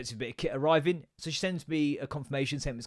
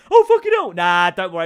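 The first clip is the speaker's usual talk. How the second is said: loud, high cry of alarm, easing off; shouted outburst that quickly settles; mock alarm; 3 semitones higher, 13 dB louder than usual. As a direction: blurt it out in hammed-up panic. Acting out mock alarm at till.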